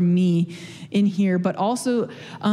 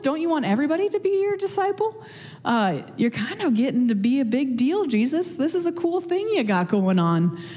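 higher-pitched, playful exasperation; mock scolding; 6 semitones higher than usual. Preacher teasingly scolding Jesus for running from followers. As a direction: tease him like a friend who blew a great chance.

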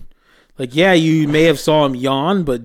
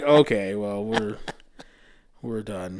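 fading resigned reply; 5 semitones lower, 8 dB quieter, slower than usual. Retold or imitated. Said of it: "I'm like".

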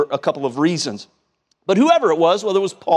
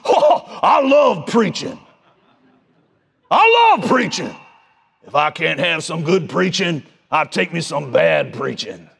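imitating a gravelly old southern preacher, slowed; a light take on the old preacher's voice; old country preacher; 3 semitones lower, slower than usual. Preacher quoting himself mid-sermon, mildly gravelly drawn-out southern voice. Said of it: "He had a gravelly old southern voice".

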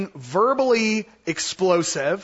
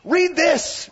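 high, loud, pushy interruption; mock Bible-thumper yelling; 9 semitones higher, 4 dB louder, slower than usual. Teacher mimics a Bible thumper in the moment, yelling back. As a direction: butt in over the yelling, pitch way up. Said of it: "they're yelling at you and you're like".

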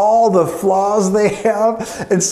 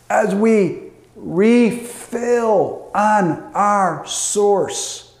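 slow emphatic repeat; much slower than usual. Real, not acted. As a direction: spell out each word of "refill on our source" slowly.

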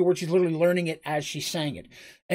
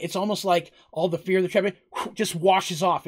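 ordinary talk, nothing marked

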